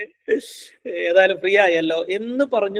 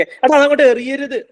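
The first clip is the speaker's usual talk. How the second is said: higher, louder demo reprimand; mock parental scolding; 7 semitones higher, 7 dB louder than usual. Shown as a demonstration. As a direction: tell a child off, as a bad example. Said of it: "എന്ന് പറയരുത്".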